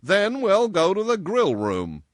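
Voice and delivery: loud, high, sudden idea; inspired. A narrator's reading. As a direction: announce it as a bright solution. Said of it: "he replied with inspiration".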